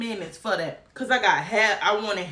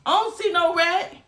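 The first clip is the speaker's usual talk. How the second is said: shouting disbelief; 8 semitones higher than usual. Retold or imitated. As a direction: yell it like you can't spot it. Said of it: "my binocular eyes was looking like".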